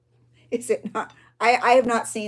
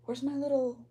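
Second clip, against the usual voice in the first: sudden gasp, then a higher, hushed question; mock gasp; 6 semitones higher, 6 dB quieter than usual. Journaler gasping at her missing knife, mock alarm. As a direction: gasp as if the knife just vanished.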